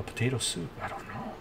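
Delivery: ordinary delivery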